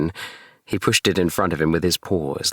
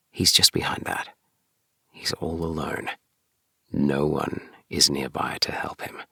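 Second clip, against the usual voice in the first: whisper; 5 dB quieter than usual. A narrator's reading. Hushed aside to listening boys.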